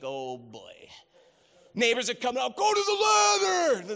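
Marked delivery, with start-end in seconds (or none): 0.0-0.7 much softer, under-the-breath groan; muttered aside. Retold or imitated. New guy in the neighborhood, muttering in embarrassment. 2.6-4.0 high, loud, slow neighbor's yell. Retold or imitated. Neighbor shouting from the yard to the stuck kid. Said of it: "This is my neighbor".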